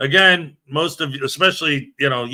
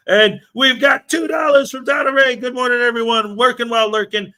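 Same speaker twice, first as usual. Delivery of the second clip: excited; 6 semitones higher than usual. Real, not acted. Excitedly reading a donation.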